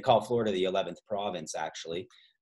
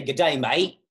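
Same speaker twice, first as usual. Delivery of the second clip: broad Aussie "Good day, mate"; put-on Australian greeting; Australian accent; 3 semitones higher, 5 dB louder than usual. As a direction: say it like an Australian, vowels widened to "die, mite". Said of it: "not even in their accent".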